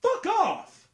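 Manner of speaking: loud, high yelled fuck off; angry shout. Real, not acted. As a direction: blow up at him. Fed up with co-host.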